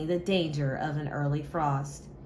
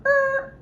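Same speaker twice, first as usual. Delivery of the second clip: startled squeak; 7 dB louder than usual. Acted. Mice stop short.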